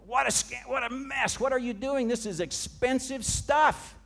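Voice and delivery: high, loud retold protest; indignant disciples. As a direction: voice the disciples' outrage over the wasted nard. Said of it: "and he goes".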